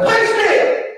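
high shouted "Be still!" as a command. Shown as a demonstration. Preacher shows the congregation how to command, shouted "Be still!". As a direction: bark "Be still!" high at the storm. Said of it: "You just gotta say".

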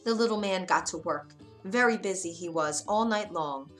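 usual speaking voice

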